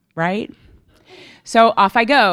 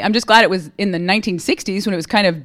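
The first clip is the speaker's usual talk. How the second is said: usual speaking voice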